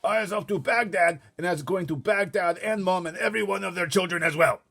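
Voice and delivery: very high, loud brute giant; shouting giant. Acted. Giant voicing Flesh Lump Eater shouting, loud. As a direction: bellow like a bullying giant. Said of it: "shouting at me".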